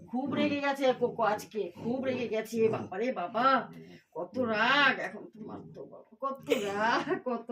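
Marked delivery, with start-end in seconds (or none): none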